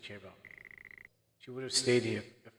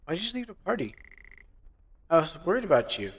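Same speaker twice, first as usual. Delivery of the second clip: put-on higher, louder girlfriend asking anxiously; worried girlfriend; 7 semitones higher, 6 dB louder than usual. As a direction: sound worried, pitched up.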